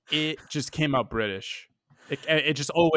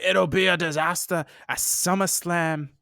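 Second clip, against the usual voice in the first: badly faked Bushwhackers accent, higher; muddled British-Irish accent; 4 semitones higher than usual. Quoting the wrestlers' promo, botched Scottish-Irish-British accent. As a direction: attempt a New Zealand accent and land somewhere British. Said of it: "somewhere between Scottish, Irish, and, and British".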